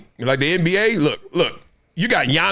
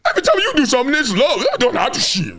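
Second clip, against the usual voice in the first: high, excited imitation of roommate Pat; a hint of an envious teammate; imitating Pat; 8 semitones higher, faster than usual. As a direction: put on Pat's pitched-up complaining voice. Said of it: "That's how he talk".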